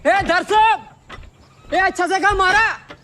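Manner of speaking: high-pitched hail; calling out. Acted. Neighbour hailing people in the morning, calling out.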